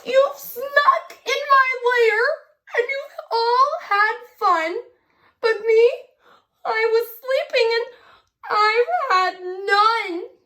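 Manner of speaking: high, whiny bear complaint; grumpy bear. Acted. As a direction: whine like a cranky woken bear.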